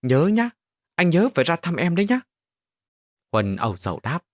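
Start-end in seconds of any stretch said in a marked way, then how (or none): 0.0-2.2 put-on higher, louder, quick childish pleading; a little boy making his friend promise; naive child. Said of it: "ngây ngô dặn lại". (giọng trẻ con ngây ngô, dặn dò)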